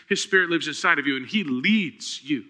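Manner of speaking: normal talking voice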